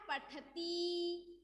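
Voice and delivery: पठती drawn out syllable by syllable, very slow; teacher stretches पठती slowly so pupils hear each sound; slow spelled-out demo. Shown as a demonstration. Teacher slowly models पठती for the class. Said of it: "तो क्या बन गया?".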